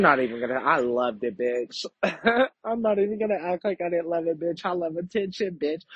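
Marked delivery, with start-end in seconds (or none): 1.9-2.6 a high burst of giggling; high giggle. Real, not acted. To listeners, after admitting she loved it, a high giggle. Laughing at her own confession. 2.6-5.8 high, fast, still laughing; giggly confession. Real, not acted. To listeners, admitting she loves attention, laughing.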